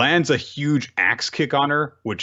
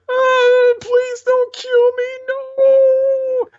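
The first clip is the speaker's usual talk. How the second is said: very high, slow squealed plea; mock terrified begging; 7 dB louder, much slower than usual. Kobeni begging the Bomb Devil, high mock terror. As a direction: squeal for mercy like a cartoon.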